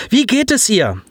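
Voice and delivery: suddenly keen, voice climbs; voice rising. A narrator's reading. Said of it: "Die Stimmfrequenz seines Freundes steigerte sich".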